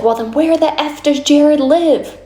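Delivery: mock yell. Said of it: "She's, like, yelling".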